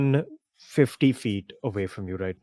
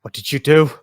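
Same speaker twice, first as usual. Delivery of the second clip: louder, higher startled question; first take, raised but held; alarmed demand; 5 semitones higher, 8 dB louder than usual. Acted. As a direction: demand an answer in shock. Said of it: "Debria's just like".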